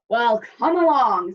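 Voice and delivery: loud big goat challenge; loud as the troll. A narrator's reading. Big goat daring the troll, loud voice. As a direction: dare him loudly as the big goat. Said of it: "as loud as the troll's".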